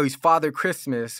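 normal talking voice

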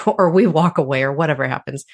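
ordinary delivery

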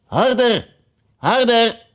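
high urgent shout; Red Queen calling harder harder. Acted. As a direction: urge her on, calling out. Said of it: "bleef de koningin maar roepen".

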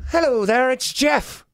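high, lilting stab at an Irish accent; attempted Irish accent. Shown as a demonstration. Giving co-host a run-through as Irish bar owner, attempted Irish accent. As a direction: try an unconvincing Irish accent. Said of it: "can you do an Irish accent?".

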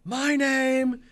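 high, slow, drawn-out ring announcer intro; Paul Heyman. Acted. Co-host impersonating a wrestling hype man, grandly drawn out. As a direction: stretch each word out in a hype-man impersonation. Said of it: "going into my Paul Heyman impersonation".

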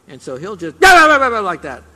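sudden loud barking imitation, slowed; preacher barks like his dog to wake the congregation. Shown as a demonstration. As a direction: bark like the yappy dog to jolt the room. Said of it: "I did that to wake some of you up".

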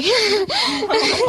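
a high, breathless laugh as tears come; teary laugh. Real, not acted. Student answering pastor, laughing as she nearly cries. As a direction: laugh through welling tears. Girl overcome mid-answer.

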